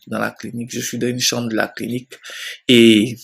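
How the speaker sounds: ordinary talk, nothing marked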